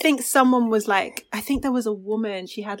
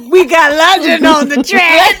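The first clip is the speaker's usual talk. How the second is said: shouted producer tag through laughter, much louder and higher; laughing producer tag; 8 semitones higher, 14 dB louder than usual. Pretend. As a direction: hype the producer tag while cracking up.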